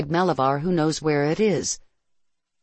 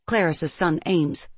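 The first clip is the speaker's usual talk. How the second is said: ordinary delivery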